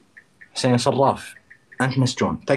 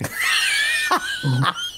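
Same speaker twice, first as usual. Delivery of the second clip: high shrieking laugh. Real, not acted. Burst at rival's jab.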